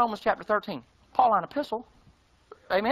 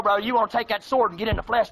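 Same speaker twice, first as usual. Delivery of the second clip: ordinary talk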